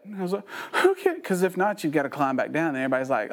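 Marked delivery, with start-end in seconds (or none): 0.8-1.2 high, squeaky mock-nervous reply; hammed-up timid squeak; nervous agreement. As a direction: agree reluctantly in a small high voice. Scared reply on the high platform.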